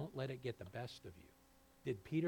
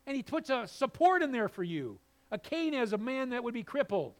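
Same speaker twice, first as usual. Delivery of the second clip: loud, high, driving sermon voice; full-force preaching; 10 semitones higher, 11 dB louder than usual. Acted. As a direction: preach it out to the pews.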